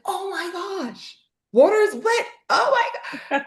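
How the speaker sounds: mock amazement. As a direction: gush in fake astonishment over the obvious. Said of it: "And they're like".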